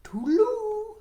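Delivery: a cheerful frog waving goodbye; cheery high frog goodbye. Acted. Froggy goodbye.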